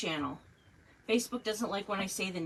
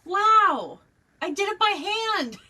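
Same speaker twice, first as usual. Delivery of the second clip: high, loud thrilled cry; bursting with pride; 12 semitones higher, 11 dB louder, slower than usual. Real, not acted. Thrilled at success.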